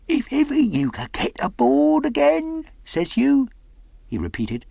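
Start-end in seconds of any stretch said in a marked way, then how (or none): 0.1-3.5 high-pitched, sly castaway; startled slyness. A narrator's reading. Castaway repeats the boy's words back, startled and sly. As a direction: perk up in a high, crafty character voice. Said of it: "perked up into a kind of startled slyness".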